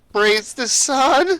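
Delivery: high, very slow, questioning echo; unsure little girl. Acted. Girl unsure how to respond to the salute, high hesitant echo. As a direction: copy it uncertainly in a child's high voice. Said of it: "not knowing kinda how to respond".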